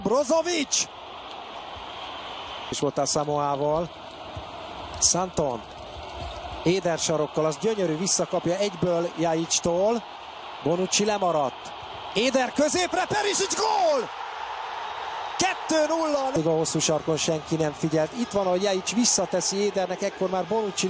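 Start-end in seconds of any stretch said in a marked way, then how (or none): 0.0-0.9 high excited cry; excited name call. Real, not acted. Chance called in live commentary. 12.1-16.3 soaring high goal shout; excited hungarian sports commentary. Real, not acted. Second goal called live.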